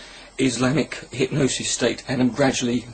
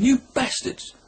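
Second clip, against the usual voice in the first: high, ranting mock insult; hammed-up indignant outburst; jeering internet troll shout; mock outraged yell; 11 semitones higher, slower than usual. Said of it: "the Facebook page going".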